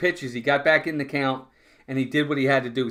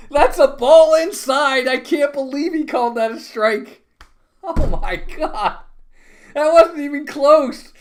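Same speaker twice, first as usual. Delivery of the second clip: high, loud, disbelieving outcry at the umpire; outraged protest; 9 dB louder, slower than usual. Real, not acted. Fan watching a walk called a strike, outraged and loud. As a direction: yell at the bad call like you were robbed.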